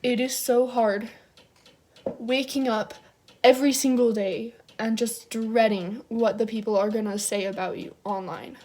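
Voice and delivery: ordinary talk, nothing marked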